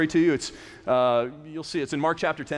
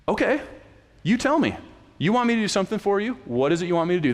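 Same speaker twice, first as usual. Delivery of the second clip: higher, louder, eager offer; an animated voiced Jesus, higher and louder; lively Jesus; 6 semitones higher, 4 dB louder, faster than usual. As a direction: playfully ask what they want. Jesus voiced, gently asking disciples.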